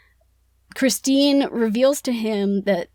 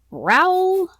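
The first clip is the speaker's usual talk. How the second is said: usual speaking voice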